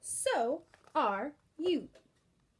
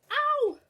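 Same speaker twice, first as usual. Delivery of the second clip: mock ow; 12 semitones higher, 8 dB louder than usual. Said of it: "And he says".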